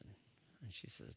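ordinary talk, nothing marked

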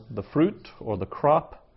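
usual speaking voice

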